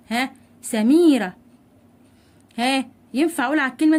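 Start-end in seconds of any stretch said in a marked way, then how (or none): 0.6-1.4 سميره slow and loud, "sa-meee-ra"; سميره sounded out for the class; lengthened vowel. Shown as a demonstration. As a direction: lean on the long "mee" in سميره. Teacher repeats example word slowly.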